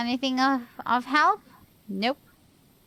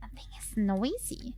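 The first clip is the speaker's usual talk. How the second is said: whispered, barely there; scared whisper; 9 dB quieter than usual. Real, not acted. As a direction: breathe it out, frightened. Spooked by a noise in the dark.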